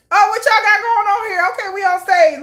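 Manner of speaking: high, loud breezy mock voice of idols wandering on; casual nonchalant idol. Fan imitating idols wandering onstage, nonchalant high voice.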